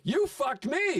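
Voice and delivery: high-pitched acted-out accusation; indignant retort. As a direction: throw the blame back, pitch jumping up. Acted quarrel with angry buddy.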